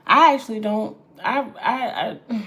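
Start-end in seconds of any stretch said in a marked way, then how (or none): none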